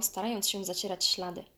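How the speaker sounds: ordinary delivery, nothing marked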